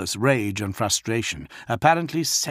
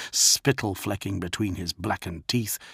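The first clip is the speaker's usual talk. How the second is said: usual speaking voice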